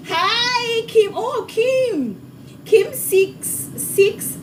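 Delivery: high, stretched hello; real delight, pitched high; delighted greeting. Real, not acted. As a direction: greet a welcome viewer in a high voice.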